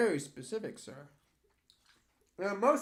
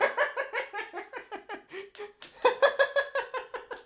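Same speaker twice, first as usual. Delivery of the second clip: squealing high-pitched laughter; 3 dB louder than usual. Real, not acted. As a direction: burst out laughing. Reacting to question.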